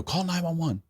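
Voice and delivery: high, urgent order to call for help. Retold or imitated. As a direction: tell her to dial, urgent and high.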